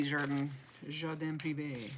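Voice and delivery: "Jardin Privé" said slowly in a French accent, ending low; a careful French "Jardin Privé", unsure of it; lightly French "Jardin Privé", slowed down. Shown as a demonstration. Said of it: "I have to say it with an accent".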